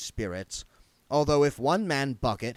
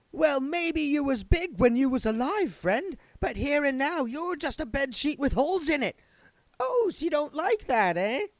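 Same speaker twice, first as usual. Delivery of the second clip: much higher, cocky ghost taunting; goading spirit guide; 4 dB louder than usual. Acted. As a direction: needle the bigger ghost.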